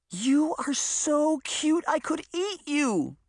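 very high, slow, gushing squeal. Acted. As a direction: squeal over something adorable. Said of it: "she squealed".

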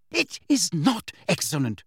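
high, indignant rebuttal; cross, emphatic objection; indignant contradiction. A narrator's reading. Hermione angrily contradicts Fred.

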